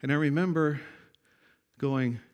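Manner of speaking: ordinary talk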